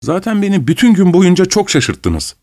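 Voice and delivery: high-pitched, nasty reproach; accusing and heated. A narrator's reading. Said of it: "diye başladı kötü bir şekilde".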